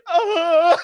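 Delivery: high yelp of fright; mock kid yelp. Bo imitates his child self yelping at the belt. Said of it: "And I'd be like".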